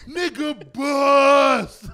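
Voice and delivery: slow, loud drawn shout; movie quote shout. Retold or imitated. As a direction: shout it out slowly.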